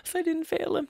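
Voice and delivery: a mom relieved she is blameless; relieved mother. Acted. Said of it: "worrying my parents".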